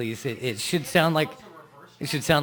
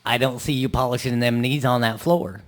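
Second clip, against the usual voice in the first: Texas preacher; 3 dB louder than usual. Acted. Texas preacher bit continues.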